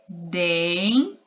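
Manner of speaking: Dentes split in two, first syllable stretched through its n; drawn-out final n. Shown as a demonstration. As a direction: hold the n of Dentes, pause, then tes. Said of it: "ele tá no final da sílaba".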